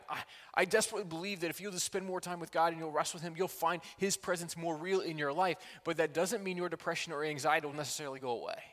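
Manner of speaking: normal talking voice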